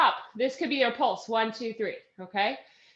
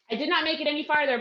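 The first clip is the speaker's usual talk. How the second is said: normal talking voice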